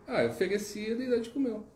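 put-on a small boy stating the obvious; innocent little boy.

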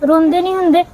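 soothing mother. Acted. Mother comforting her crying child, high and soothing. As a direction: coax the little one gently out of tears.

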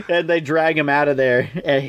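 normal talking voice

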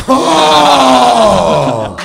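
long, loud "ohhh" sliding down; mock-sympathetic wail. Host commiserating over the rejection, mock-sympathetic wail. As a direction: draw out a big theatrical pitying oh.

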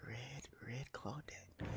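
slow, hushed whisper; stalker's whisper. Pretend. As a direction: whisper it slowly while stalking prey. Killer quietly stalking a survivor.

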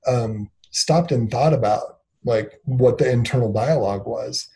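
ordinary delivery

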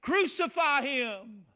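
slow, high, loud mock crowd cry; a preacher acting the jeering crowd; crowd screaming. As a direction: drag out the mob's demand. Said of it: "was screaming".

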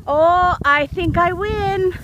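usual speaking voice

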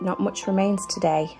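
usual speaking voice

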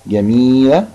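جميلة with the ee stretched out; long yaa in جميلة. Shown as a demonstration. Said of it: "فيها مد بالياء". (مدّ الياء في جميلة)

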